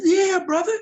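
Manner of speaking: admitting to the brother he thought he'd lost it, high and loud; emphatic yes. Retold or imitated. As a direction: blurt it out loud, eyes wide. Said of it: "I said".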